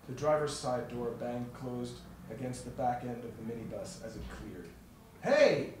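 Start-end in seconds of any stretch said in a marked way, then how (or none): 5.2-5.7 sharp, high loud yell; startled angry shout. A narrator's reading. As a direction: shout after the fleeing car. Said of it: "Jarvis shouted".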